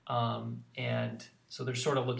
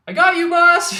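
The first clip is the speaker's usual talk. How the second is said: much higher, loud squeal of a sidekick; Nimona shouting; 19 dB louder than usual. Acted. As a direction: yell it like an overeager kid. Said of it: "You know, and, like".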